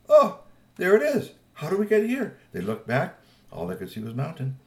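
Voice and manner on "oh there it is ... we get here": high, loud startled exclaim; suddenly spotting the old jeep; surprised. Acted. Friends surprised to find the wrecked jeep, high and loud.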